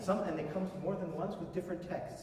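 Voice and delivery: ordinary talk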